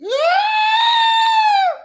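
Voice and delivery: a long, high, crying sigh; high tearful sigh. Real, not acted. Emotional sigh after talking about gratitude. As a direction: let out a sob-like sigh. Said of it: "tears of joy".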